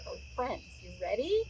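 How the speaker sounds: usual speaking voice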